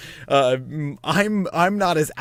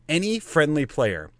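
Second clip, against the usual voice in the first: normal talking voice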